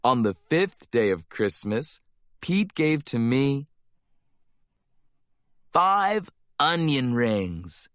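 plain reading voice

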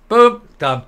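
sudden loud, high punch on boom. Real, not acted. Vlogger gloating to landlords, loud punchy boom. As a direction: slam the word down like a door shutting. Gleeful punchline about evictions.

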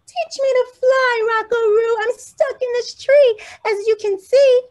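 a tiny bird asking eagerly to be taught; high bird voice, pushing to be heard; small bird voice. Acted. Birdie asks raccoon.

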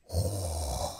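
long, deep exasperated sigh; heavy sigh. Real, not acted. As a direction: let out a weary sigh. Exasperated at company reply.

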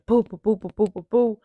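rapid thudding footsteps sound; imitated footsteps. Woman imitating him coming down the stairs, thudding. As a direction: voice the pounding feet on the stairs. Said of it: "I hear him coming down the stairs".

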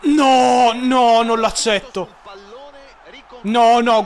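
loud outraged no; angry shouting. Real, not acted. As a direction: shout your refusal at the screen. Conceded goal, outraged.

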